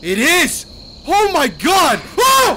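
high, loud bursts of alarm; startled screaming. Real, not acted. Player seeing Chica appear, startled yelling. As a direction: yell it as the scare hits. Scare confirms his guess.

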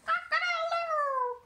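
high, loud rooster crow; crowing proud rooster. Acted. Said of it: "crowed the rooster".

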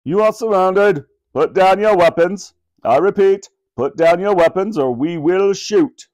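police helicopter megaphone warning. A narrator's reading. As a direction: bark orders through a megaphone. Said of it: "A voice came over the megaphone".

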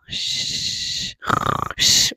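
rattling snore with shushing out-breath; snoring. Acted. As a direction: fake a sleepy bear's snore. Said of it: "begins to snore".

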